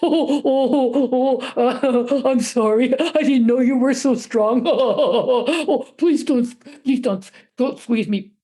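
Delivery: blubbering, cowed troll voice; pleading troll. A narrator's reading. Troll begs the boy not to squeeze him, whimpering. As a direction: beg with whimpering, laugh-like sobs.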